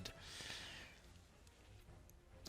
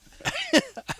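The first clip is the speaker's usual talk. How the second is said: burst of laughter; 11 dB louder than usual. Real, not acted. Laughing at Boyo name.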